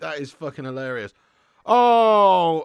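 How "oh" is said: sudden loud Oh of surprise; surprised shout. Real, not acted. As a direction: burst out at the surprise.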